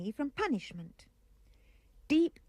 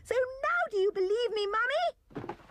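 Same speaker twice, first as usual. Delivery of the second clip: put-on much higher, near-crying child's plea; boy begging mother, almost in tears; excited pleading child; 13 semitones higher than usual. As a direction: beg mother to believe now.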